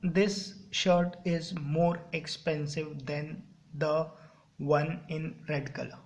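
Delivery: very slow, word by word for copying; spaced-out dictation pace. Shown as a demonstration. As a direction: dictate it so the class can write. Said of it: "कुछ भी बोल दो, this".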